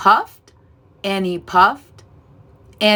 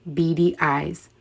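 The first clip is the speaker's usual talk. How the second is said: ordinary delivery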